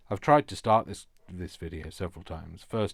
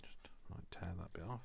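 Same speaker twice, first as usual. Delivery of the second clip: murmured under her breath while working; whisper; 10 dB quieter than usual. Real, not acted. Crafter whispering to herself while tearing paper off. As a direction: whisper it to yourself as you concentrate.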